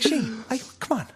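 very high mock dad voice; imitating dad. Dad brushing off Shane, high mock voice. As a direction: whine it like a defensive dad. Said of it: "It's like".

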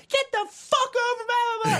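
very high screechy mom impression; slow falsetto mock scolding; yelling mom. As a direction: shriek it like a furious mother. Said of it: "got yelled at by his mom".

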